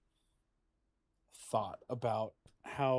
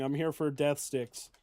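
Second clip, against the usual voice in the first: ordinary talk, nothing marked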